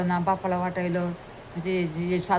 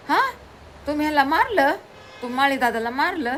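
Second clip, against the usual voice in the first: surprised mother; 6 semitones higher than usual. Acted. Mother asks her sons at the window, high and surprised. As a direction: ask it high and delighted.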